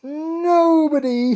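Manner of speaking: very high, loud, slow joyful cry; king sliding down the banisters; gleeful king. Acted. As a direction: whoop it with glee.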